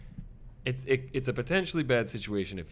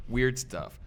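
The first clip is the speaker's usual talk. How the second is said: ordinary delivery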